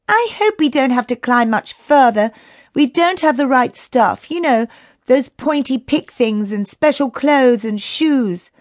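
put-on high-pitched, fretting child character; worried young Chrissy. A narrator's reading. Chrissy frets about climbing the mountain, high worried voice. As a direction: pipe up as a worried little climber.

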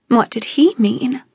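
ordinary delivery

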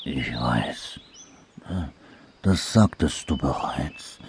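low, soft, downcast murmur. A narrator's reading. Sad anteater replying to dormouse, low murmur. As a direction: mumble it quietly with long pauses. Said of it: "murmelte der große Ameisenbär".

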